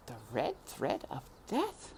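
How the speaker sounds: soft, frightened echo; repeating the sentence in dismay, quietly; small voice. A narrator's reading. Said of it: "repeated Despero in a small voice".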